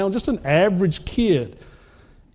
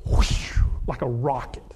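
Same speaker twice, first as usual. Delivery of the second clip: whoosh sound; 7 semitones lower, 4 dB quieter than usual. Retold or imitated. Ball flying past.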